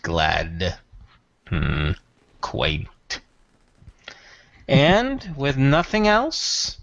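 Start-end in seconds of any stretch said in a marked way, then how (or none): 0.0-3.2 low, coldly amused; dismissive. Acted. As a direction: sniff at the word. Tyrath's dismissive repeat.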